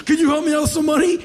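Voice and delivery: high, loud, rapid crazed begging; deranged street beggar. Acted. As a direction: beg like a raving madman. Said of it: "old grizzled fifty-something-year-old psychotic men".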